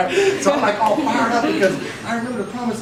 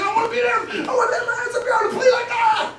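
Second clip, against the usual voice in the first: eager wishing; 4 dB louder than usual. Retold or imitated. Wishing for a long career.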